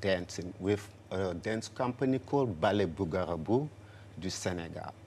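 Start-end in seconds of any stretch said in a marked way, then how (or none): none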